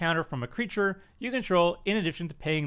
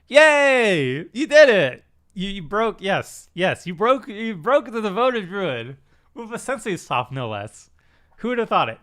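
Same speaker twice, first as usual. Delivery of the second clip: mock celebration; 4 semitones higher, 6 dB louder than usual. Mock celebrating the infinite combo.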